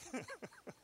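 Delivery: high giggle. Real, not acted. Preacher laughs at own joke.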